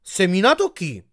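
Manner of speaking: startling big-voiced interruption; booming. A narrator's reading. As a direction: thunder it from behind them. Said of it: "Una voce dietro di noi tuonò".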